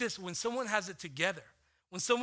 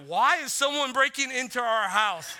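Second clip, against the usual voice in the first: put-on higher, louder, upset kid asking; teary alarm; 6 semitones higher, 10 dB louder than usual. Daughter scared by her dad's break-in question, tearful and alarmed. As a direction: ask through tears like a frightened child. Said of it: "with tears in her eyes".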